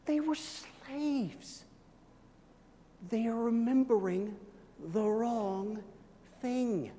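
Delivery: soft, very slow, weighty statement; preacher softly repeating that they were slaves; hushed emphasis. Real, not acted. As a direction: let it sink in quietly.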